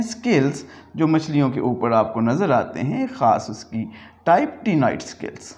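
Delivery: usual speaking voice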